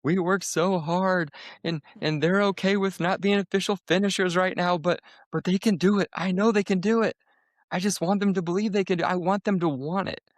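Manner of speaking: high, strained, near-tears plea, slowed at first; pleading to the pacer with the voice cracking upward; retold tearful plea. To Lindsay at the start line, begging through tears. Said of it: "I actually start, like, crying".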